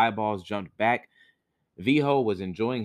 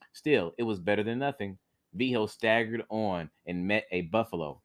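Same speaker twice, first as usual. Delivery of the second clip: ordinary delivery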